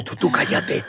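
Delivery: whispered scolding; whispered hush. Retold or imitated. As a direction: hiss it so the teacher won't hear. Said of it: "Y ella dijo".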